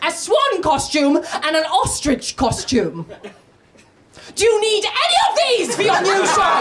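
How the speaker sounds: high, drawn-out eager mother voice; imitated mum on phone. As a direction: offer each item like an over-keen mum. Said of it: "She went".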